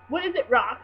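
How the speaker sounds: shouting the name out; scream. Acted. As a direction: yell it across the room. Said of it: "he screamed".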